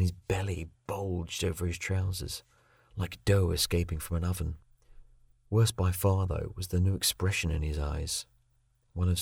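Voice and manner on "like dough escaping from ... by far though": usual speaking voice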